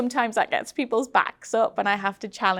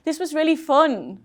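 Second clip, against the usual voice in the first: mock breezy; 8 semitones higher, 5 dB louder than usual. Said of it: "I don't want people saying".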